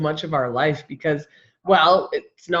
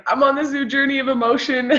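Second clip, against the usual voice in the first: higher, playful quoting of a friend; teasing imitation; 6 semitones higher than usual. Friend fondly quotes her catchphrase back, lightly mimicking. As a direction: mimic your friend's declaration, amused. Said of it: "I love how you say".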